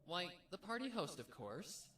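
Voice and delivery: high, loud playful answer; tossed off with a giggle in the voice; happy giggly. Acted. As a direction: Pinkie cheerfully explaining to her sister. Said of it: "She giggled happily".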